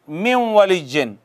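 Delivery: "मिम वली जिन" with the n melted into a nasal doubled w; इदगाम के साथ. Shown as a demonstration. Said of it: "ये शद लग गई". ("मिम वली जिन" में नून को वाव में मिलाकर)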